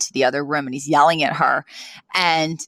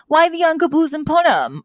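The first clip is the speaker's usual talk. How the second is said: imitating Yetta; 11 semitones higher than usual. Voicing Yetta to Maggie.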